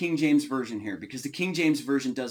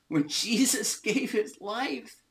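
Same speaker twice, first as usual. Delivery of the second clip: choked up; 5 semitones higher than usual. Real, not acted. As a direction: voice breaking with emotion. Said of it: "I'm gonna start crying here".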